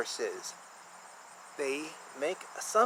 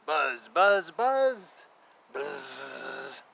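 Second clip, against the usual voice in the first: playful bee buzz, louder then fading; 4 semitones lower, 4 dB louder, much slower than usual. Pretend. Imitating bee buzzing for kids.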